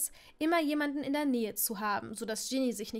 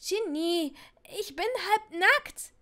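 much higher, slow squeak of embarrassment; 7 semitones higher, 4 dB louder, slower than usual. Acted. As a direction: squeak in mortified panic. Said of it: "kiekste nun Neville". (Neville kiekst beschämt, viel höher)

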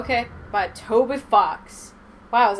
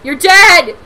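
shouting; 6 semitones higher, 18 dB louder than usual. Real, not acted. Shouting during game fight.

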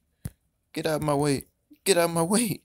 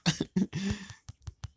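breathy rueful laugh; 8 semitones higher, 4 dB quieter than usual. Real, not acted. Gamer laughing at own bad luck, rueful laugh.

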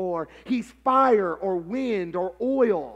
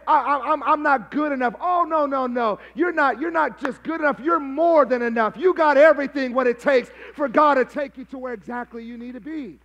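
raised, urgent preacher's voice; fervent preaching; 3 semitones higher, 5 dB louder than usual. Real, not acted. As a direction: drive the encouragement home to the congregation. Preacher builds to forceful encouragement.

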